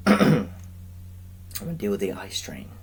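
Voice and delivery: cracking voice. Real, not acted. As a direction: voice breaking on a word. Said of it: "My voice cracked again".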